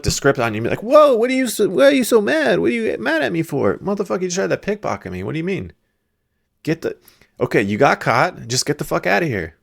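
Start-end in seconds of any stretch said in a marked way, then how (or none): 0.8-3.8 high-pitched mock innocence; playing innocent. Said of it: "they'll try to play innocent". 3.8-9.6 high-pitched mock indignation; exasperated retort. Acted retort to caught pickpocket.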